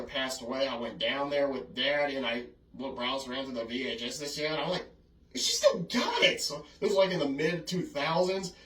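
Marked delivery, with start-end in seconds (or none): 5.3-6.4 very high, slow squeal of disbelief; astonished discovery. Retold or imitated. Finding Grandma's old tape, retold astonishment. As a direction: re-enact amazement, stretching the words.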